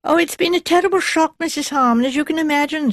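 high, thin, reedy character voice; thin, reedy woman. A narrator's reading. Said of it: "Her voice was thin and reedy".

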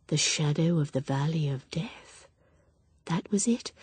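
soft hushed whisper. A narrator's reading. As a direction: recall the words as in a dream. Drowsy recollection.

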